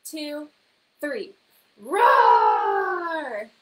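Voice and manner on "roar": huge loud tiger roar. Shown as a demonstration. Teacher leading the class in a loud tiger roar. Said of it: "say it in your best tiger voice".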